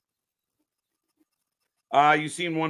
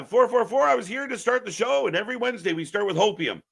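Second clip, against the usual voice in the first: usual speaking voice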